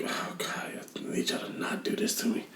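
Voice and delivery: hushed, voiceless mock pleading; whispered plea. Player pleading with game makers not to change things, whispered. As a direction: whisper it like a private, pained prayer. Acts out his private pleading.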